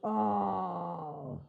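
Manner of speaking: drawn-out pained groan; loud groan. Real, not acted. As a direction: let out a long disgusted groan. Groan after soap joke.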